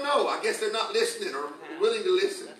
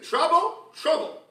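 Lord's warning; 11 semitones higher, 6 dB louder, much slower than usual. Retold or imitated. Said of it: "He just said".

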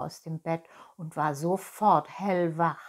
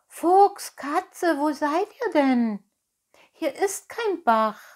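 much higher, loud searching call; 8 semitones higher, 7 dB louder, slower than usual. Acted. Pinocchio calls for fox and cat across the field, loud and high. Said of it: "rief Pinocchio".

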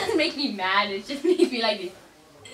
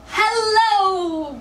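1 loud hello; 7 semitones higher, 4 dB louder than usual. Acted. Redoing the intro, calling out.

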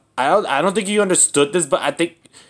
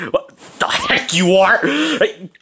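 ordinary talk, nothing marked

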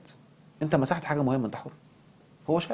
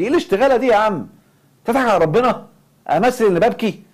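incredulous outrage; 11 semitones higher, 9 dB louder than usual. Real, not acted. Outraged rant at staged crying.

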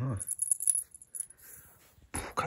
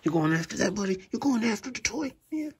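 pet talk; 11 semitones higher than usual. Real, not acted.

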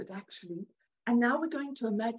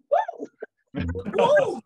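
mock exclamation; 10 semitones higher, 5 dB louder than usual. Batting away thought.

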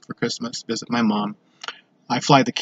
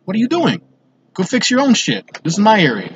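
imagined angry confrontation; 7 semitones higher than usual. Pretend. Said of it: "I'm just gonna be like".

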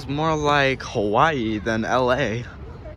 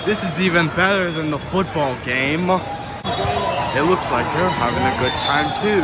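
raised, louder delighted chatter; bright, louder and higher with delight; excited; 6 semitones higher, 6 dB louder than usual. Real, not acted. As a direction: gush about the fun you're watching. Amused at watching a party.

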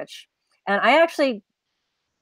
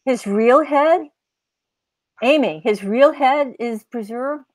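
higher, louder, rising incredulous questions; can't believe what she's hearing; disbelief; 7 semitones higher, 5 dB louder, slower than usual. Real, not acted. Hearing a real head is on display, stunned disbelief.

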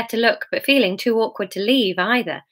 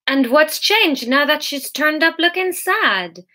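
slow, higher, unsentimental challenge; belligerent; 7 semitones higher, slower than usual. A narrator's reading. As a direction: challenge them slowly, pitched up. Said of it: "was belligerent and unsentimental".

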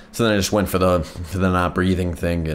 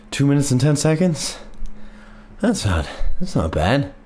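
out of breath; 7 semitones higher than usual. Real, not acted. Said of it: "Oh, I feel really lightheaded".

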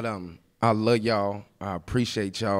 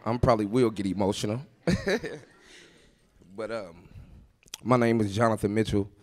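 ordinary talk

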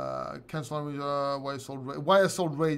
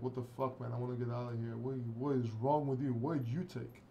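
quiet, low mock-bewildered dog; dog's imagined voice; 4 semitones lower, 9 dB quieter than usual. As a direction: mutter it like a baffled pet. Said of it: "He's looking like".

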